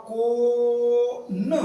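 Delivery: कोन stretched out very slowly, almost sung; demo reading. Shown as a demonstration. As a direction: draw out कोन slowly for the class. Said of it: "ओ कहाँ पे लगा?". (धीरे-धीरे खींचकर कोन)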